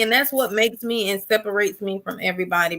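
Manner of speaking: usual speaking voice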